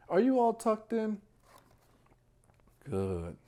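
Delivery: plain reading voice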